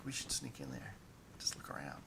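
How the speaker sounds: hushed conspiratorial whisper; soft, furtive urging; secretive whisper. Acted. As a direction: whisper a sneaky plan. Character secretly proposes sneaking in.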